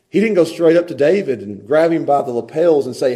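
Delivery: ordinary talk, nothing marked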